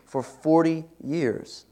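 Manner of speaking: For forty years drawn out very slowly, forty leaned on; stress on forty. Shown as a demonstration. Preacher stressing the forty years to the congregation, slow. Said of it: "Did you catch that part".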